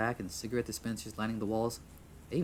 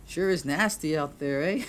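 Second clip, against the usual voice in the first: higher, louder, drawn-out call; forced small talk; 7 semitones higher, 7 dB louder, slower than usual. Acted. Filling dead air, calling out.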